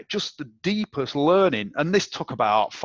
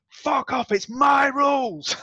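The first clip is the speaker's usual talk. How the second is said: high, loud yell of defiance, drawn out slowly; angry shout; 13 semitones higher, 4 dB louder, slower than usual. Retold or imitated. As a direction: bellow it back like slamming the floor. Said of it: "hit the floor and went".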